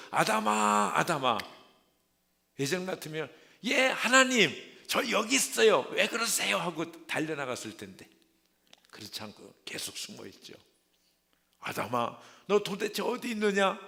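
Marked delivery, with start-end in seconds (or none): 0.1-1.4 loud, high call across the garden. Acted. God calling hiding Adam, loud searching call. 3.6-6.6 much higher, louder, bright eager answer; eager reply. Acted. Adam answering God before the fall, eager high reply. 11.6-13.8 calling out. Acted. God calling Adam again.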